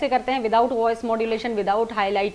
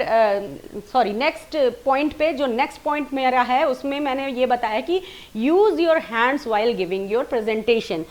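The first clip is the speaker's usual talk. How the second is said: ordinary delivery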